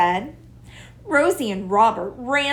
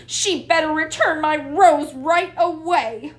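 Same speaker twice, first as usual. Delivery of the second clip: indignant; 7 semitones higher, 4 dB louder than usual. A narrator's reading. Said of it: "roared Rosie".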